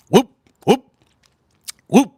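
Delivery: loud high whooping; high whoop cheer. Real, not acted. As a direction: whoop it out like a hype man. Sudden cheer mid sentence.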